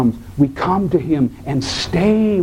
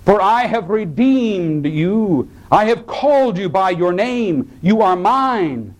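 slow, raised solemn reading voice; scripture reading; 7 semitones higher than usual. Acted. Pastor reads Isaiah to congregation, solemn and slow. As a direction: proclaim God's words slowly and reverently.

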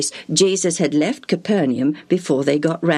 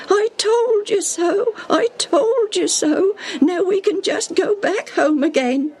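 whining; 14 semitones higher than usual. Acted. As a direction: whine an I-told-you-so, pitched very high. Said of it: "poor Tim whined".

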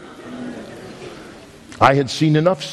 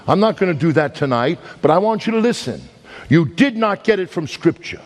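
fiery preaching; 8 semitones higher than usual. Acted. Preacher challenging Sunday keepers, fiery preaching. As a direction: drive it home to the congregation, each phrase hammered.

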